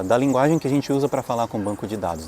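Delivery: ordinary delivery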